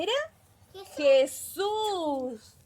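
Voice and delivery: very slow, drawn-out reveal; suspenseful, lingering delivery; storyteller's big reveal. Acted. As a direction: stretch the name out for the children. Reveals who the man was.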